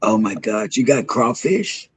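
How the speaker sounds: normal talking voice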